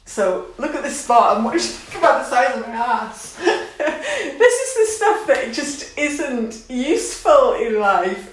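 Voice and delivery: giggling through the words; laughing. Real, not acted. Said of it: "what I'm laughing for".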